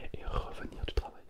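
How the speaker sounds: ordinary talk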